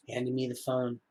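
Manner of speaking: ordinary delivery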